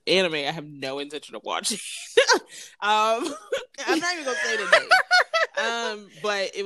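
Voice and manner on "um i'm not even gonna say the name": laughing. Real, not acted. Laughing, embarrassed rush.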